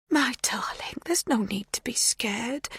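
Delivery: tender whisper. A narrator's reading. Magda soothes the girl.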